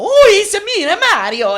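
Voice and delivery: high, loud cartoon Mario; squeaky in-game plumber greeting; hammed-up Mario. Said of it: "they go".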